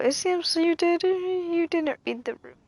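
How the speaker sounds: ordinary talk, nothing marked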